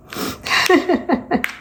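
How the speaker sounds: airy high giggle; breathy laugh. Real, not acted. Laughing at son's quip.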